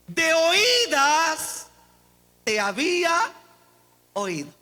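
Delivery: proclamación aguda y alargada que cae al final; emocionado. Real, not acted. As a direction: lee el versículo con emoción, estirando el inicio y pausando entre frases. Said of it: "como que solo yo me emocioné". (prédica emocionada)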